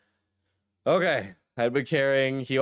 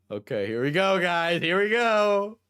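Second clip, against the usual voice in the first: hyped bracing; 9 semitones higher, 4 dB louder, slower than usual. Real, not acted. Bracing before the explicit part.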